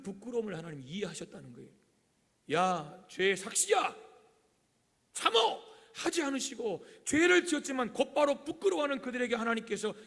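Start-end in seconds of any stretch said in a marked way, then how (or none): 2.5-5.6 hammed-up angry shout; mock scolding. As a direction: yell at them as a harsh God would. God hypothetically scolding sinners.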